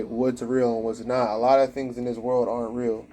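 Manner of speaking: ordinary delivery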